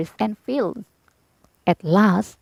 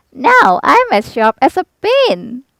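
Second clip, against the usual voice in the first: slow, high, louder silly voice; proud Scarecrow; 4 semitones higher, 6 dB louder than usual. A narrator's reading. Scarecrow boasts about his new pins, slow and high. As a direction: boast it slowly like a pleased straw man.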